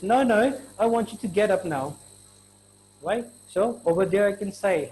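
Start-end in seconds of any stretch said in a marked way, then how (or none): 0.0-2.0 insistent order. Shown as a demonstration. Ordering lazy friend up now.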